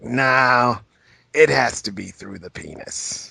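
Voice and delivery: low, slow talk-show guest; Willem Dafoe. Acted. Proud actor on the talk show with a big smile, Willem Dafoe impression. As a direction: say it low and drawn out through a big grin. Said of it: "picturing him on the talk show being like".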